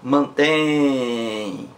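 Mantém with the weight on the last syllable tem; teacher showing that tem is the strong syllable; stress on tem. Shown as a demonstration. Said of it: "A sílaba mais forte é tem".

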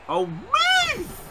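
very high squealed command; worked-up squeal. Real, not acted. Gamer urging a wrestler aside, worked-up squeal. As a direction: shoot right up into a squeal. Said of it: "very worked up now".

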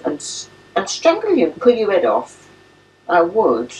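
put-on high, babyish threatening of a pet; playful mock threat. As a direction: threaten the dog in a high, teasing voice.

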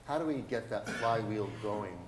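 ordinary delivery

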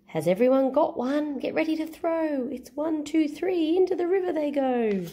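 put-on high, eager girl counting down; excited girl voice. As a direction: call the countdown to her friends. Girl starts the race.

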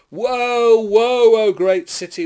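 loud, high cry of woe; crying out in lament. A narrator's reading. Weeping seafarers cry out over the burning city, loud lament. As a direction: proclaim the grief at full voice. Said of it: "with weeping and mourning cry out".

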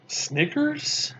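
high, rising disbelief; puzzled question. A narrator's reading. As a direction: query the name, baffled. Boy puzzled by strange name.

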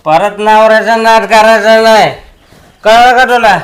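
loud stern warning. Acted. Husband warns wife loudly never to cross him. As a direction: lay down the law.

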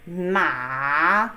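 Ma dipping low; third tone ma. Shown as a demonstration. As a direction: dip Ma down and up. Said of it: "So ready?".

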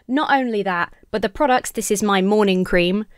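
ordinary talk

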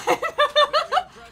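real high-pitched laughing fit; helpless high giggle; high burst of laughter. Real, not acted. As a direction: crack up laughing, pitch shooting up. Laughing at own poor time.

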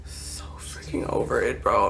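whispered, utterly over it; fed-up whisper. Real, not acted. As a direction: whisper it to yourself in exasperation. Spent, muttering after the outburst.

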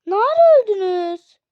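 loud, high cry of disbelief; shocked outburst. Real, not acted. Gamer to dead teammates, loud shocked question. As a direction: shout it, stunned that they died.

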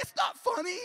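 high, loud mimicked complaint; exasperated mock protest. Husband answering his laughing wife on the phone, exasperated high mock shout. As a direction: throw it back loudly at the same high pitch. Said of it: "I'm like".

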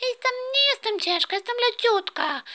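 put-on little sister protesting to her brother, high child voice; indignant little girl. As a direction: insist you're light as a feather. Little sister protests being heavy.

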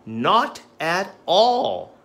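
slow, loud "Not at all"; slow emphatic. Shown as a demonstration. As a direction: draw it out firmly. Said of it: "flashcard drill demo".

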